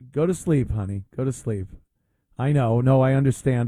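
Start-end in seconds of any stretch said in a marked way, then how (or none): 0.1-1.7 hushed, lower mock comfort to a sobbing partner; mock soothing. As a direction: pretend to calm a crying wife, softly. Mock soothing the crying woman.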